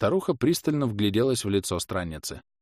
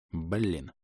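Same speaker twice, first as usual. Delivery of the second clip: low, soft muttered curse; inner thought; 3 semitones lower, 7 dB quieter than usual. A narrator's reading. Inquisitor cursing in his head, low and soft. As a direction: drop it under your breath. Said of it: "пронеслась у меня мысль".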